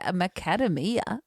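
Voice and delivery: "macadamia" queried as "muh-KAD-uh-mee-uh"; "macadamia" mispronounced, as a question; puzzled echo. Retold or imitated. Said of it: "I said".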